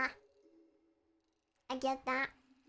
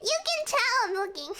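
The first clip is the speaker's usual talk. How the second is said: laughing while spinning; 8 semitones higher, faster than usual. Real, not acted. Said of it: "I'm swinging on my chair".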